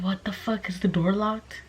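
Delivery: hushed fear. Retold or imitated. Home alone, banging heard.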